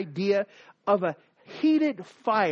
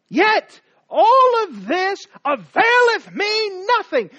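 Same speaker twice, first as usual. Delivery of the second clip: high, loud, slow shouted outburst; enraged cry of frustration; furious shout; 11 semitones higher, 9 dB louder, slower than usual. Acted. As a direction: bellow it in bitter rage. Haman's climactic outburst.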